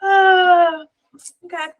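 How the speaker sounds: loud, high cry of disbelief; exasperated outburst. Real, not acted. Host hearing that carrots are labelled gluten-free, crying out in exasperation. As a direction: let out a loud, pained groan at the absurd label.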